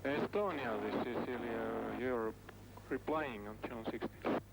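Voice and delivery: ruhiger Funkspruch, verrauscht; Seefunk. Real, not acted. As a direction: klingt wie über Schiffsfunk. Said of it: "den Notruf der Estonia auf".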